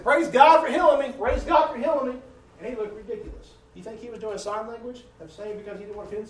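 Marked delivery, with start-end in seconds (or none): 0.0-2.2 testifying praise. Retold or imitated. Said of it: "he said".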